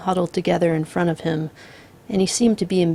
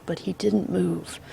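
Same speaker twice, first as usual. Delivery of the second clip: plain reading voice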